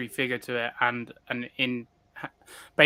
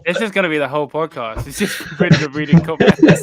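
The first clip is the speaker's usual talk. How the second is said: laughing, getting louder towards the end; laughing tease; 4 semitones higher, 8 dB louder than usual. Real, not acted. Co-host teasing host about reading comments all podcast, laughing. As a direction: tease him while cracking up.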